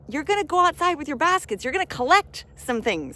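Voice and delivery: high, loud, eager push outside; rally them to grab the blessings; excited call. Real, not acted. Coach rallying listeners to gather abundance, high and eager. Excited urging, money raining.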